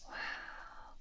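ordinary delivery

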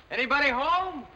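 high, loud drawn call. Acted. Guest checks whether anyone is home, calling out. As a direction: holler through the house for an answer.

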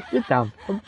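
says it through a laugh. Real, not acted. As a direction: giggle the words. Repeated while laughing.

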